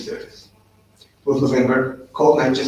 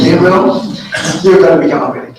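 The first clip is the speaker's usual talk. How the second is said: laughing quote of the commanders; 4 semitones higher, 7 dB louder than usual. Retold or imitated. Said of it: "So they said to me".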